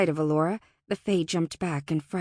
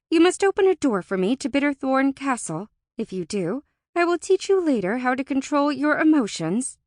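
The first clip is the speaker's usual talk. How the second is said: higher, louder statement of terms; resolute Alora; 6 semitones higher, 5 dB louder than usual. A narrator's reading. Alora states her bargain to Deegan, firm and emphatic. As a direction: lay down terms firmly.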